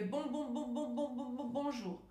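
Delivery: rapid-fire repeats of the first syllable before the word; stuttered repetition. Shown as a demonstration. Speech coach shows word repetition to avoid, stuttering. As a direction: stutter on BON, bouncing it over and over, then BONJOUR. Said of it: "d'éviter de répéter les mots".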